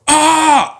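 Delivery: sharp high mock yell; retold scream. Taunting scream in dream retold.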